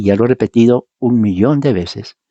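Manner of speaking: normal talking voice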